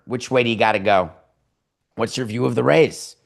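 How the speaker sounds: ordinary talk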